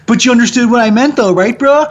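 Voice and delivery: mock the casual "you get me" guy; descriptivist bro. Imitating people who shrug off grammar, mock bro voice. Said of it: "a lot of people are like".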